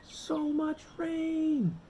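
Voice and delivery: high and slow, stretched out; slow, lifted build of rain; drawn-out high. A narrator's reading. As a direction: stretch each word up high as the rain piles on.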